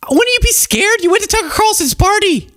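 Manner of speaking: very high-pitched exasperation; incredulous. Real, not acted. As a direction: squeal it in disbelief. Escalated rant at absent Candace.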